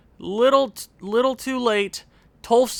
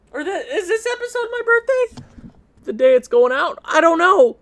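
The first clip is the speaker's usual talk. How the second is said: much higher, bouncy; giddy confusion; 9 semitones higher than usual. Real, not acted. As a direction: puzzle it out playfully. Confused about birthday.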